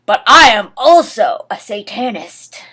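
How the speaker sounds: loud, theatrical declaration; a proud dramatic announcement; hammed-up proclamation. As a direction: declaim it like a villain's reveal. Dramatic reading of fanfic quote.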